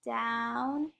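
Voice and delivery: DOWN held long, lifting at the end; drawn-out DOWN. Shown as a demonstration. Teacher leading pupils as they skywrite, drawn-out DOWN. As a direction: stretch DOWN to match the stroke. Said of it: "you say it with me".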